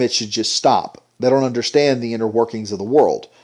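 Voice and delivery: ordinary talk, nothing marked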